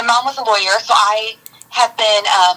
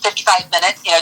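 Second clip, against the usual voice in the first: normal talking voice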